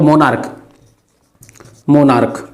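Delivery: "मोनार्क" low, with a final k; correct pronunciation. Shown as a demonstration. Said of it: "मोनार्क ये पढ़ेंगे". ("मोनार्क" के अंत में क की आवाज़)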